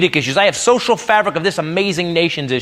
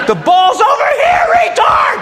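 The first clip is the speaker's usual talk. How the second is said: mock sideline yell. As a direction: bellow it across the field like a rude dad. Dad yelling at kid's game.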